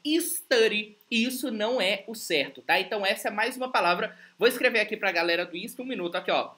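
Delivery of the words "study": Study said as istudy, a vowel before the s; mock mispronunciation istudy; imitating Brazilian istudy. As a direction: do the typical learner's istudy. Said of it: "o que que a gente fala?".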